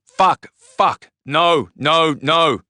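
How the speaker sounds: high, loud repeated screams of horror; acted screaming, each word a separate burst; shrieking. A narrator's reading. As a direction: shriek in disbelief at what's found. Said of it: "The shriek of Matt's discovery".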